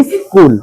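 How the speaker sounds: স্কুল as "is", pause, "kul"; split is-kul. Shown as a demonstration. Teacher dictates to the class, স্কুল split as is-kul. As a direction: break স্কুল into two syllables with a leading i. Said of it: "হর্ষই দন্তে সরকার একটা হসন্ত দাও".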